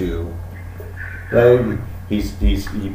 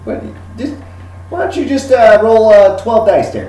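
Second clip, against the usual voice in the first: high, alarmed whoas, then a hushed aside; startled gun dealer; 12 semitones higher than usual. Acted. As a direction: rein the customer in, flustered. Dealer stops customer smoking.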